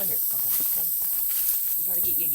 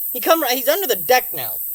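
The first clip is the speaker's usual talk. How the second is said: usual speaking voice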